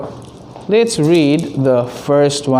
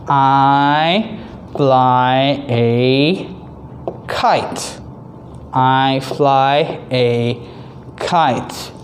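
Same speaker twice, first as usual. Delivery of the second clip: slow reading aloud; 3 semitones lower, much slower than usual. Shown as a demonstration. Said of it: "and number three".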